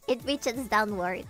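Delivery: ordinary talk, nothing marked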